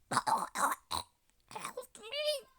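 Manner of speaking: high, loud play-acted distress call; pretend cry for help. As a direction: wail for rescue in make-believe peril. Hurt character calls for help.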